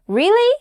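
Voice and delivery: "Really?" climbing high at the end; rising question tone. Shown as a demonstration. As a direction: let "Really?" lift sharply, as a question. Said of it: "your voice goes up".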